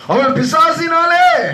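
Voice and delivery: high, drawn-out acted plea; pleading mother. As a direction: stretch out the desperate appeal. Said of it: "வந்து வேண்டிக் கொள்ளுகிறாள்". (மன்றாடும் தாயின் நீண்ட கதறல்)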